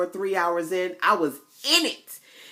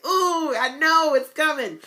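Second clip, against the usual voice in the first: high, loud and slow, eager anticipation; excited ooh; 11 semitones higher, 6 dB louder, slower than usual. Retold or imitated. Watching her event pop up, eagerly excited. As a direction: savour it like a treat. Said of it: "and I'm like".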